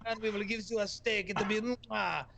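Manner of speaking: lightly hammed-up French accent, amused; a light, pleased mock French accent; French locals. Parisians promising him a steak, in a light mock French accent.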